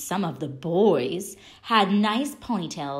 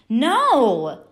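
high, loud flat refusal; emphatic no; 5 semitones higher, 7 dB louder than usual. A narrator's reading. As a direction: shut mom down hard. Stephanie refusing her mom.

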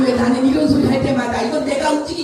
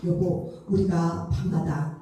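soft, shy, slowed-down voice; hesitant bashful murmur; shy hushed husband; 8 semitones lower, 7 dB quieter, slower than usual. Retold or imitated. Shy husband proposes code word. (수줍게 작은 목소리로)

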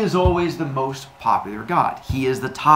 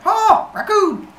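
much higher, loud slow shriek of fright; startled shriek; 7 dB louder, slower than usual. Pretend. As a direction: shriek at the raccoon as it looks up. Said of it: "and you're like".

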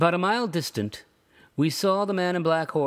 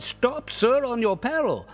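high, forceful stop order; commanding cry; 10 semitones higher than usual. A narrator's reading. Toll gatherer crying out to the rider to stop, forceful. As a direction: halt the rider on his peril.

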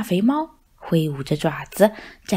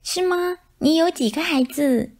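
high, lightly voiced curious mice; chirpy little mouse question; 8 semitones higher than usual. Mice ask. (小老鼠好奇地问，声音尖细)

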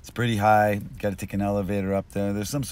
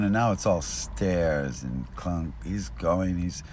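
ordinary talk, nothing marked

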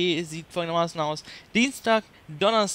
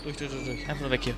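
tense hush; 5 semitones lower, 6 dB quieter, faster than usual. Real, not acted. Sneaking past enemy.

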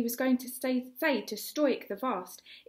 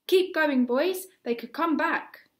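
urgent call; 7 semitones higher, 7 dB louder than usual. A narrator's reading. Gobber urging boys to flee.

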